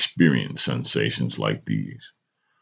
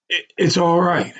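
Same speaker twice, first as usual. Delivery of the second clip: choked up. A narrator's reading. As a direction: hold it together for mother. Son concluding reassurance.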